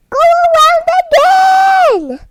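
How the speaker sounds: high, loud cheer urging them on; excited cheer. A narrator's reading. Parent reading to a child, cheering the dogs on. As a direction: call it out like urging racers around.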